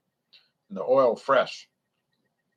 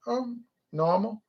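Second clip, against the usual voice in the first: mock shrug; 10 semitones higher, 4 dB quieter, slower than usual. Acted testing dialogue, answer.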